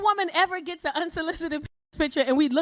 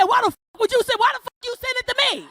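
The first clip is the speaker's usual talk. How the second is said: higher, louder indignant yell; mock angry man; 9 semitones higher, 3 dB louder, faster than usual. Angry man scolding her for sending a picture back, high indignant yell. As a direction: sputter it out, offended. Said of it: "They be mad and say".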